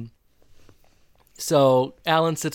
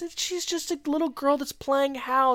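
high-pitched, light dismissal; breezy reassurance, imitated; 11 semitones higher than usual. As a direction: brush the worry off in a high voice. Amy brushing off Alan's worry.

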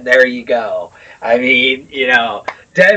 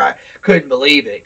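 ordinary delivery

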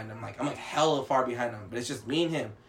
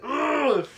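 high, loud growl of pent-up rage; frustrated groan; 12 semitones higher, 5 dB louder than usual. Retold or imitated. Driver stewing in the car with family, frustrated groan. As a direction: re-enact a fuming groan. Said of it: "I never been so mad before".

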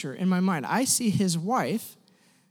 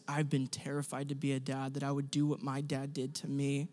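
much softer, low retold confession; barely getting it out; 6 semitones lower, 11 dB quieter than usual. Friend confesses his fear of fatherhood, hushed and low. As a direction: drop low and quiet, as if words come hard. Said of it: "he just can hardly get out".

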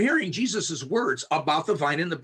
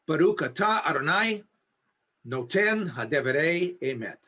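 measured, deliberate prayer recited in Hebrew; slow Hebrew blessing. Shown as a demonstration. Pastor leading his muted Zoom class through the blessing, slow and deliberate. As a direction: give each word room so the class can say it along. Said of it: "I'll say it slow enough that you may want to".

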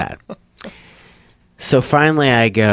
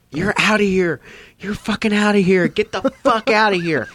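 retold angry ejection; 10 semitones higher than usual. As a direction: throw the heckler out in a high, indignant voice.

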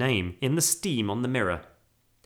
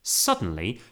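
ordinary delivery

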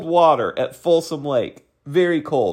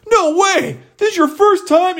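very high-pitched delighted disbelief; hammed-up, high excited surprise; amazed enthusiasm. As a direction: imitate a thrilled stranger finding out. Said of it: "lose their enthusiasm for me".

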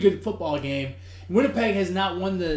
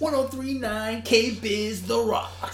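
radio announcer voice; 5 semitones higher than usual. Pretend. A mock radio promo.

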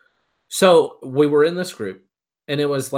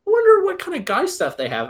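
much higher, naive curious voice; mock innocent wonder. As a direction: play the innocent shopper. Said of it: "But I'm like".

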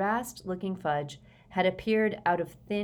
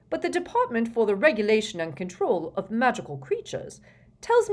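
raised, louder bureaucratic patter; officious minister; 5 semitones higher, 6 dB louder than usual. A narrator's reading. Fudge notifies the Prime Minister of dragons, officious and loud. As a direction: reel it off like an important official. Fudge's part in story narration.

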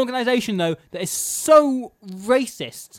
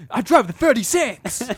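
proud bus driver; 8 semitones higher than usual. Retold or imitated. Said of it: "It's like, just like".

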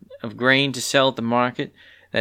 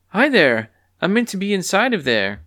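eager hen greeting; 9 semitones higher, 4 dB louder than usual. Acted. Clucky greets bored guard.

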